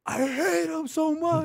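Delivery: high, loud, slow mock cry; imagined bitter outburst. Imagining the coworker crying out against his father. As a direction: ham up an anguished wail. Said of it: "I thought he was gonna say something like".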